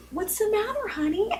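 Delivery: high, soft mothering voice; hushed high-pitched baby talk; concerned mommy. Retold or imitated. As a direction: gently coo to a sick toddler. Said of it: "And I was like".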